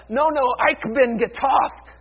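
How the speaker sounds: loud, high, slow German declaration; defiant cry. Acted. Believer refusing to go it alone, loud defiant cry.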